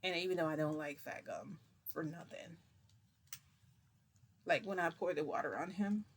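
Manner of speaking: ordinary delivery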